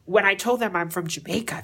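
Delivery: usual speaking voice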